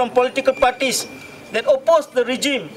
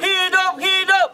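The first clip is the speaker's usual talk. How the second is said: fiery revolutionary speech; 7 semitones higher than usual. Real, not acted. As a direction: chant it out to the crowd. Leads protest chant call.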